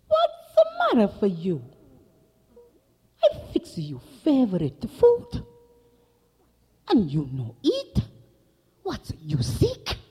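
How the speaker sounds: loud, immigrant-mother scolding; hammed-up worried mama in broken English; fussing Mama. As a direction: fuss loudly over an uneaten dinner.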